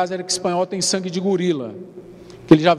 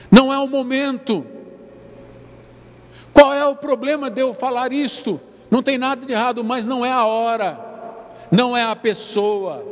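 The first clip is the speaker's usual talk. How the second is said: higher, ringing preacher's call to the congregation; preaching at full force; 4 semitones higher than usual. Acted. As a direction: proclaim it, lifting the room. (pregação inflamada, voz mais aguda e vibrante)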